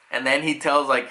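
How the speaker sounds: usual speaking voice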